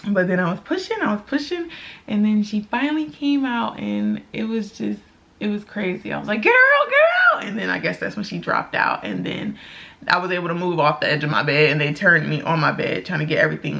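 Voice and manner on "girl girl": put-on high, loud girl girl; excited shout. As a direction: cry out as the baby comes. Calling out as baby arrives.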